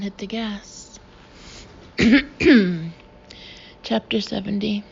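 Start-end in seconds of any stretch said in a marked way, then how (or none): 2.0-2.9 quick throat clearing. Real, not acted. Throat clearing between chapters.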